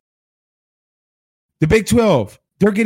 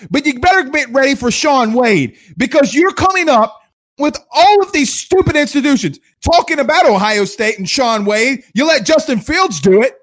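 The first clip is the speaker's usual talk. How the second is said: ordinary talk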